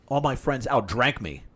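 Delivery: ordinary talk, nothing marked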